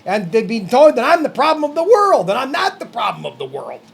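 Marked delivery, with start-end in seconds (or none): 1.0-2.3 high, rising mimicry of an accuser; mock accusation. Fed-up man echoes what he has been told, indignant. 2.4-3.8 low, soft, settled denial; firm denial. Real, not acted. Fed-up man answers his accusers, low firm denial.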